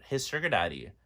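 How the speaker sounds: usual speaking voice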